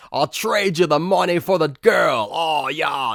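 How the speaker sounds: high, hammed-up Australian accent; mock Aussie villain proposing a swap; Anthony Starr. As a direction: call it out in a broad Aussie accent. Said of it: "he's got an Aussie accent".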